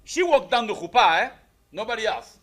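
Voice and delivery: usual speaking voice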